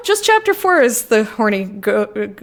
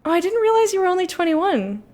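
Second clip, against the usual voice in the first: normal talking voice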